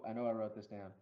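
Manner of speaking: normal talking voice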